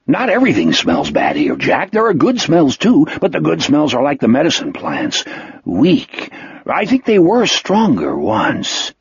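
earnest character voice with wide pitch swings; a simple creature musing wistfully; Wolf, wistful. Acted.

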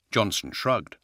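ordinary delivery, nothing marked

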